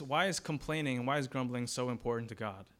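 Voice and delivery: ordinary talk, nothing marked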